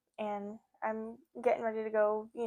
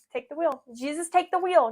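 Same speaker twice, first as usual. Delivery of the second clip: higher, louder dramatic plea, big and showy; 5 semitones higher, 6 dB louder than usual. Retold or imitated. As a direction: belt it like the country song. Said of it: "Not like [name] said".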